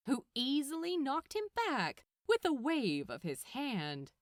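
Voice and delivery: ordinary delivery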